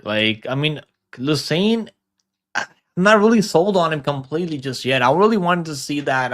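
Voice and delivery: normal talking voice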